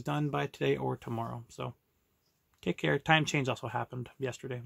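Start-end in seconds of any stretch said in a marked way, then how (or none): none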